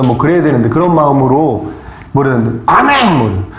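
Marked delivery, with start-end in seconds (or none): 2.7-3.2 shouted amen. Retold or imitated. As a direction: blurt it out. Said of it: "막 그러는 거예요".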